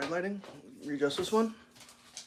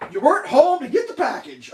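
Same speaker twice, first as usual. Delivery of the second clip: high, loud mocking voice; mocking quote; 9 semitones higher, 6 dB louder than usual. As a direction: parrot the excuse mockingly. Mocking the delivery claim.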